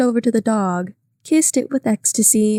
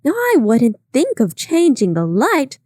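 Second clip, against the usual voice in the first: cried out; 5 semitones higher, 3 dB louder, slower than usual. A narrator's reading. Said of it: "cried Missus McKee".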